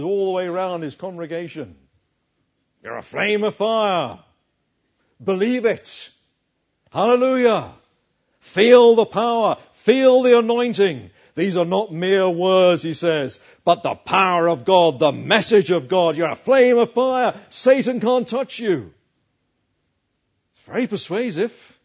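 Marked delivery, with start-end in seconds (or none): 2.8-12.8 higher and louder, hyped-up revival preacher; mock charismatic preacher. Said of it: "I won't say it as he says it". 13.6-18.9 mock charismatic preacher. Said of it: "I won't say it as he says it".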